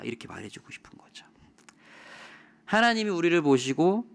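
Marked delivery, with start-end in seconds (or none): 0.0-1.3 whisper. Real, not acted. Preacher's aside after quoted speech.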